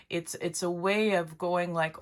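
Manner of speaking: ordinary talk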